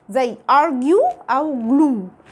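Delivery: "argue" and "glue" said very slowly, ending on long yoo and long oo; slow word demo. Shown as a demonstration. Teacher contrasts two example words, very slowly. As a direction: draw out both words to contrast the vowels. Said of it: "لونج يو أو لونج أو".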